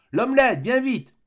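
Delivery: high, loud demanding call; impatient shout. Acted. Young lady ordering her omelette from another room, loud high shout. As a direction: bark the order from a distance.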